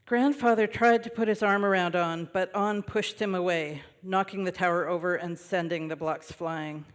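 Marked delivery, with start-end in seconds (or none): none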